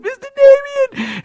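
very high, loud, drawn-out squeal. Retold or imitated. Older friend interrupting the praying preacher, loud high squeal. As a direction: call insistently like an excited old man. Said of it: "Ed's an older gentleman".

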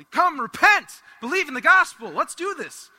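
loud, high revival-preacher call; hammed-up call to repentance; mock preaching. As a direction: proclaim it like a fired-up preacher. Imagining himself preaching on repentance.

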